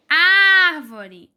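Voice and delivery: Árvore with a loud, stretched first syllable Ár; Árvore said loudly, Ár held long; stress on AR. Shown as a demonstration. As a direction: hit the Ár of Árvore hard. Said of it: "É o ar".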